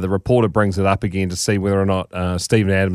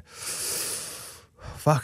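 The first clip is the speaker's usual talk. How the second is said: whispered curse; 5 semitones higher, 12 dB quieter than usual. Retold or imitated. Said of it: "you just go".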